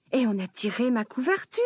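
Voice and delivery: usual speaking voice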